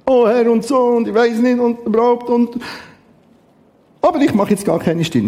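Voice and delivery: leicht gespielter, zögernder Beter, etwas höher; zweifelnder Beter. Retold or imitated. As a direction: spiel einen unsicheren Menschen, der betet. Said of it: "stille Zeit auch mit Zweifel anfangen". (ein Zweifler betet, leicht höher und zögernd)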